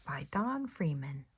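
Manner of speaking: ordinary delivery, nothing marked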